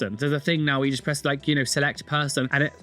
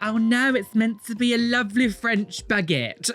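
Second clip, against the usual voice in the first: higher, prissy mock defense; mocking the tattoo owner's excuse, lightly higher prissy voice; 6 semitones higher than usual.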